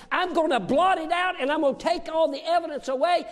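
loud, high, building shouting sermon; full-force preaching. Acted. Preacher bringing the sermon to its climax, loud and high. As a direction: preach it home at the top of the voice.

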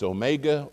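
"omega" said oh-MAY-ga; "omega" with a long MAY in the middle; first pronunciation. Shown as a demonstration. Pastor shows the congregation oh-MAY-ga. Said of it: "three ways you can pronounce it".

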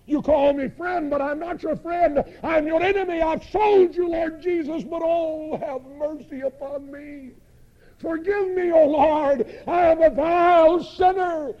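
high, loud cry for mercy; acted penitent's impassioned outcry; desperate confession. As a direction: plead at full voice as a broken betrayer. Imagined Judas confessing, begging mercy.

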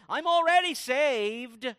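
loud, high, drawn-out protest; slow, hammed-up self-assured proclamation; mock entitled boast. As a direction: imitate someone loudly claiming special status. Voicing the entitled people's boast.

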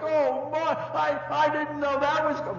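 high, loud mock-whimpering toddler; imitating crying child. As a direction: play the caught child bawling his excuse. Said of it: "he begins to cry like".

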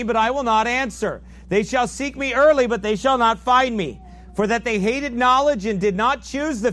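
fiery preaching. Acted. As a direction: thunder the warning at the congregation. Preaching the proverb at full force.

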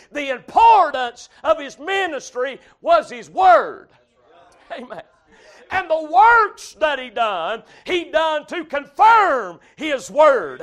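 loud swells on the key words; slow, weighty pulpit proclamation; full-force preaching. Acted. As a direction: drive the point home to the congregation.

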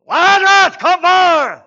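huge high-pitched shout; full-voice, drawn-out preacher's cry; loud scream. Acted. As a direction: scream the command as if to wake the dead. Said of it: "why in the world did he scream that loud".

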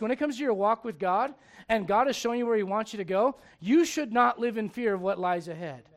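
ordinary delivery

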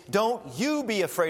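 emphatic "you" in a gentle command; stress on YOU. Shown as a demonstration. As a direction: put the weight on "you", not on "don't". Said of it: "the emphatic you or ye".

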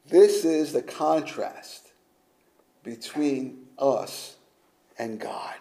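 quiet, slow reverence; hushed. Real, not acted. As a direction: let it sink to a whisper. Hushed sermon climax.

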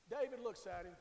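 ordinary talk, nothing marked

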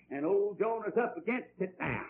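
ordinary talk, nothing marked

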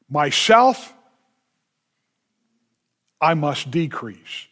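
"Myself" lifted, then "I must decrease" falling and dropping away; emphatic stress. Shown as a demonstration. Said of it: "in the emphatic".

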